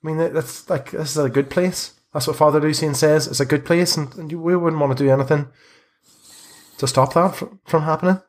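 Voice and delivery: lightly voiced anxious boy. Boy denies anyone would harm the bones, anxious. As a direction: raise the voice, protest naively.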